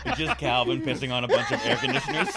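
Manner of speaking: talking through loud laughter; cracking up mid-sentence; laughing. Real, not acted. Laughing while describing a picture.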